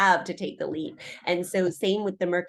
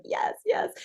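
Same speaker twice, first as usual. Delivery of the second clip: excited yes; 12 semitones higher than usual. Retold or imitated. Mimicking her own excitement.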